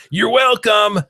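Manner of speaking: loud high-pitched yell. Retold or imitated. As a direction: shout it across the room. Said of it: "yells as loud as she can".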